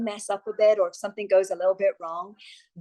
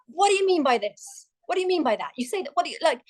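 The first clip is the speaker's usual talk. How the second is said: higher-pitched, pushy grilling interviewer; Vanessa Feltz impression; 8 semitones higher, faster than usual. TV host grilling a nervous guest on air, higher and pushy. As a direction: fire the questions at the guest like a tough TV host. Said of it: "That was a really good impression".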